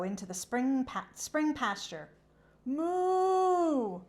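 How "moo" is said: high loud drawn-out moo; cow moo. Acted. Cow calling in the pasture, high drawn moo.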